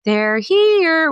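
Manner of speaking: put-on high, eerie "they're here." like the movie girl; quoting the Poltergeist line in a spooky little-girl voice. Speaker quotes the Poltergeist film in a spooky voice. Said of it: "you know, the movie Poltergeist".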